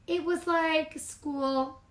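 put-on slow, high, deadpan child; bored flat reply. Sister answering mama, slow bored drawl. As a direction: drag it out, unimpressed.

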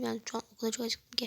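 usual speaking voice

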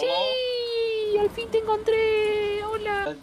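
high, slow, eerie creature voice; creepy monster voice. Pretend. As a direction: whisper it like a stalking monster. Creepy monster voice toward player.

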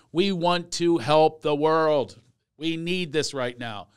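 ordinary talk, nothing marked

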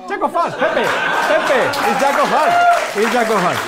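high, loud triumphant announcement; big loud reveal to the crowd. Real, not acted. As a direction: proclaim the card like a showman. Magician's reveal to audience.

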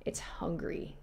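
hushed whisper of the words It's hungry. Real, not acted. Player reading eerie game text, whispered.

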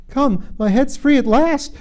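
high, loud cry of delight. A narrator's reading. Alice finds her head free at last, delighted. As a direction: exclaim it with joyful relief. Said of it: "in a tone of delight".

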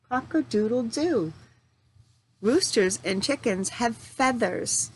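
ordinary talk, nothing marked

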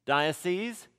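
ending said as "seez" in diocese; plural pronunciation. Shown as a demonstration. Teacher introducing the church term to the class, plural pronunciation. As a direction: say diocese with a long "eez" ending. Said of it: "it becomes diocese".

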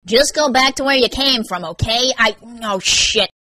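loud brush-off slowing into a groaned curse; impatient, then dismayed; exasperated groan. A narrator's reading. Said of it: "he groaned".

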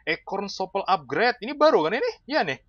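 high-pitched, eager exclaiming; excited discovery. Real, not acted. Player sees a new upgrade in the shop, excited.

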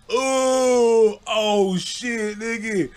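high, laughing shocked cry. Retold or imitated. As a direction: react in disbelief. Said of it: "I'm like".

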